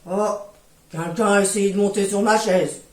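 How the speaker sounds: low and loud booming bear; big bear voice. Acted. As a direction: boom it out like a giant bear. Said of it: "Le grand ours dit".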